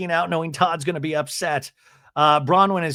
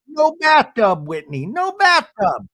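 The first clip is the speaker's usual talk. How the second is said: very high, loud, slow wail; mock anguish; 10 semitones higher, 4 dB louder than usual. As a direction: drag it out in mock grief. Husband announces no bathtub.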